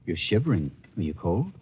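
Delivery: soft, low concern; tender. Acted. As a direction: ask gently, close and quiet.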